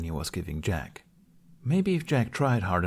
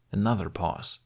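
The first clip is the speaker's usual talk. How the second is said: plain reading voice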